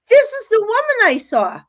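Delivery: high, urgent telling to the producer; pulling at his arm, insisting; retold excited high-pitched claim; excited high exclaim.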